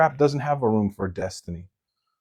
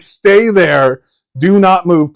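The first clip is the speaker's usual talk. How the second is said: loud, higher-pitched order; mock stern command; 6 semitones higher, 8 dB louder, much slower than usual. Homeowner ordering a filthy thing to stay put, loud command. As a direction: shout it like ordering something to freeze.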